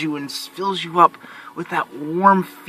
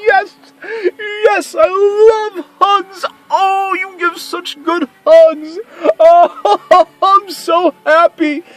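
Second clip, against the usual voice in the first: much higher, louder, slow, gushing and near tears; joyful Santa; 13 semitones higher, 10 dB louder, much slower than usual. Retold or imitated. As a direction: Santa overjoyed at the kids' hugs. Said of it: "and then I go".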